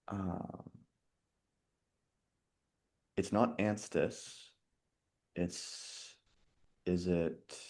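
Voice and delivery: very soft, halting pondering; hushed, hesitant working-out with long pauses; thinking aloud. Real, not acted. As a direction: murmur it to yourself while searching memory. Puzzling out answer to herself.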